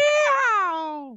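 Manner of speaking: high whooping Texan yell; Texas cowboy voice. Retold or imitated. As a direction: holler like a cowboy. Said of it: "I sound like Mister Hanky".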